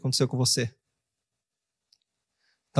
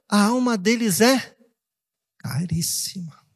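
tender divine voice; 2 semitones higher, slower than usual. Acted. God speaking of souls' value.

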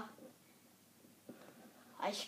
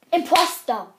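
loud, thrilled yell; gleeful outburst at being imposter; excited shout; 20 dB louder than usual. Real, not acted. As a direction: shout it out in delight. Discovers he is imposter.

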